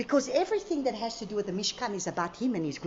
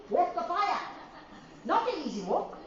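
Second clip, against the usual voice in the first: normal talking voice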